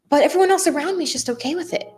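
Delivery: ordinary talk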